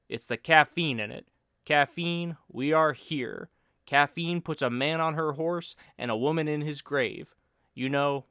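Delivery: a pal pontificating for laughs; Bill's voice. Acted. Bill sermonising about coffee and expatriates, loud mock oratory.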